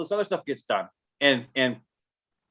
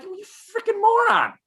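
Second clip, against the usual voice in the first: mock exasperated insult. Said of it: "And I'm like".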